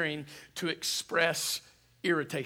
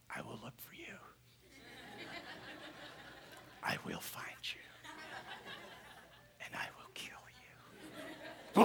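movie villain threat; 9 semitones higher, 11 dB quieter than usual. Retold or imitated. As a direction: quote Taken in a deadly whisper. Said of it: "Taken. I love it".

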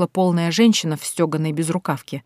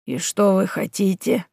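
indifferent; 2 semitones higher than usual. Acted. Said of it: "выглядела совершенно безразличной".

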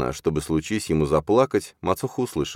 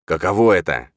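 menacing taunt; 2 semitones higher, 6 dB louder than usual. A narrator's reading. Father taunts boy while choking.

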